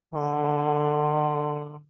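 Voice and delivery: one long held uh; long sound. Shown as a demonstration. Teacher showing a long sound after a deep breath. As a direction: hold the uh as long as you can. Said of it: "deep breath is like this".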